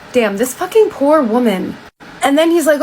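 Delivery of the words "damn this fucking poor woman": ordinary talk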